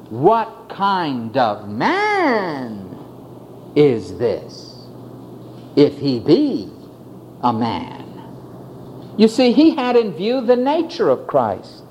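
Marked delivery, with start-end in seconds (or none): 0.1-8.0 marveling question. Acted. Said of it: "He asked the question".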